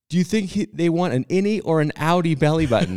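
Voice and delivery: high, loud call across the room; yelling across the room. Retold or imitated. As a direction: call out to someone far away. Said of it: "I shout across the room to Kristen".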